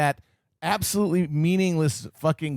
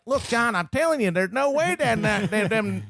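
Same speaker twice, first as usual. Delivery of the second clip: put-on yelling hillbilly accent; 5 semitones higher than usual. Imitating actor dropping his accent, yelling hillbilly. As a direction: slip into a mock Louisiana twang. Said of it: "scenes where he's yelling".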